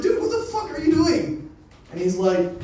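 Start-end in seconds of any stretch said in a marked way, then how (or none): 0.0-1.5 high-pitched mock outrage from the mother; shocked mom voice. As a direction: squeak it out like a horrified mom. Mom finds son beheaded.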